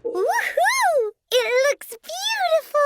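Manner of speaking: high, bright-eyed woohoo; delighted cheer. Acted. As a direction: cheer like a thrilled kid. Paimon delighted at the box.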